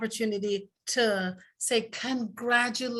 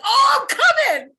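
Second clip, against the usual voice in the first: high, loud burst of eagerness; excited squeal; 11 dB louder than usual. Retold or imitated. Retelling how she invited herself, excited high squeal. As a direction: squeal it like you can't wait.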